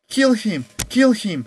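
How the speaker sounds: high, loud angry chant; bloodthirsty crowd shouting for the kill; mob yell. A narrator's reading. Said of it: "the mob yelled".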